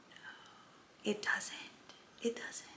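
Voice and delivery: breathy whisper, almost no voice. Real, not acted. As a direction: drop to a hush to drive it home. Quiet aside to viewers.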